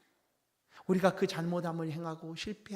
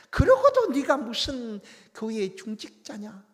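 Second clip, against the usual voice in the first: higher, much louder, slow hammering rebuke; the Lord as he did not speak, scolding church officers who failed; outraged scolding; 8 semitones higher, 11 dB louder, slower than usual. Pretend. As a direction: yell at them to come to their senses. Imagined scolding of church leaders.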